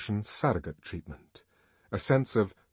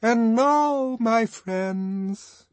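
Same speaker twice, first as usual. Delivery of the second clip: much higher, louder, ringing orator; 14 semitones higher, 7 dB louder, much slower than usual. A narrator's reading. Songster addressing the party guests, ringing orator voice. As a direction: ring out like leading a celebration. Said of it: "in that beautiful ringing voice".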